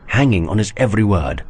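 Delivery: plain reading voice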